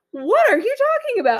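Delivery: high baffled question. Retold or imitated. Friend confused next morning.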